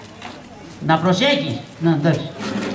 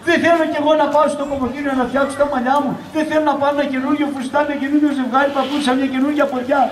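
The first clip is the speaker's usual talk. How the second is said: shouty nagging; 3 semitones higher, faster than usual. Acted. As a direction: reel off grievances without a breath. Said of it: "Μη φωνάζεις". (γρήγορη, φωναχτή γκρίνια)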